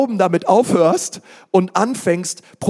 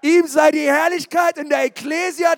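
shouted preaching; 7 semitones higher than usual. Shown as a demonstration. Pastor proclaims the verse to his church, shouting. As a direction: cry the verse out to the room with fire. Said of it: "Come on!".

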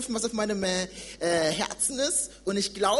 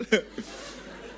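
breathy laugh; 6 dB quieter than usual. Real, not acted. Laughs after agreeing with father.

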